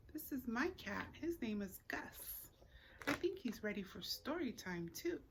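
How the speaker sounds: usual speaking voice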